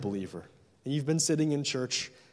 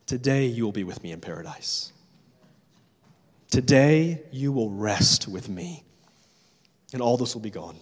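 gentle promise; 4 semitones lower than usual. Retold or imitated. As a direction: reassure the dying thief tenderly. Jesus answers the thief.

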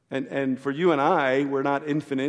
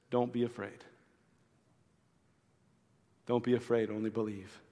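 soft, much quieter comfort to a grieving father; gentle reassurance; 3 semitones lower, 9 dB quieter than usual. Acted. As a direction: say it tenderly, almost under your breath.

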